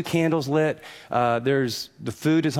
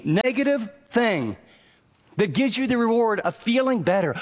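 higher, driving sermon cadence; preaching; 6 semitones higher than usual. Acted. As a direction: press the point home to the congregation. Preaching at full force.

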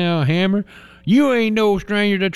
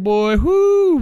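excited whoop; 10 semitones higher, 4 dB louder than usual. Real, not acted. Laughing at the hard kick.

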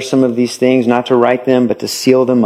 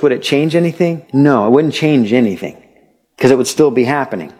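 ordinary talk, nothing marked

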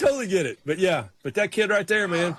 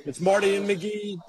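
higher, hyped radio segment tease; radio announcer; 6 semitones higher than usual. Acted. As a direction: sell the next segment like a DJ.

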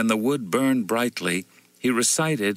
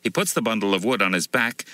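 plain reading voice

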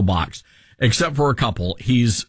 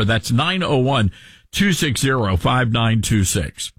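normal talking voice

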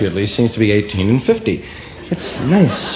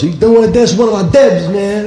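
much higher, louder mock threat; tough gang threat; 12 semitones higher, 6 dB louder than usual. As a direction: square up to a rival. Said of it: "getting into fights over girls".

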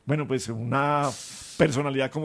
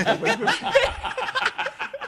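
high-pitched burst of laughter; real laughter. Real, not acted. Laughing at the banter.

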